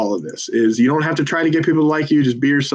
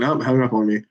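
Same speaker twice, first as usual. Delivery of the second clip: ordinary delivery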